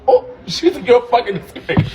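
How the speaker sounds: laughing quote. Retold or imitated. Quoting insult while laughing.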